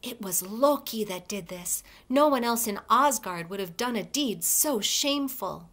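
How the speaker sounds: usual speaking voice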